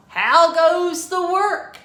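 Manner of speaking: loud, high startled call; farmer calling out. A narrator's reading. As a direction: bellow it out of bed. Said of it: "and he called".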